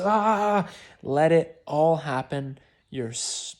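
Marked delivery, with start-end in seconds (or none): 0.0-0.7 a short, sharp, high ah burst; convulsive cry. Shown as a demonstration. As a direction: let a jolt of sound escape. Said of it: "people will convulse and just".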